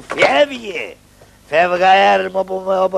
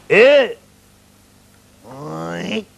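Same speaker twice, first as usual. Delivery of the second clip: ordinary talk, nothing marked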